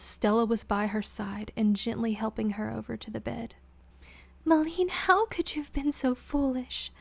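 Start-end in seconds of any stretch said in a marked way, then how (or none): 4.5-6.9 higher, hushed scolding with no heat; gentle chiding. Acted. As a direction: scold her out of worry. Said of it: "She chided her".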